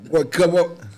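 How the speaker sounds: ordinary delivery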